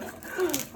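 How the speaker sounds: sharp high gasp for air; winded gasp. Real, not acted. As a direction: catch a breath after the effort. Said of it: "It got me out of breath".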